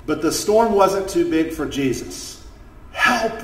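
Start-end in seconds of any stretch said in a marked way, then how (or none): 2.9-3.4 acted scream for help, higher; high-pitched storybook cry; screamed plea. A narrator's reading. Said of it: "they screamed".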